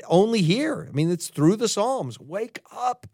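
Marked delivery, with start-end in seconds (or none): 2.2-3.1 soft plea. Retold or imitated. Pleading with a silent God.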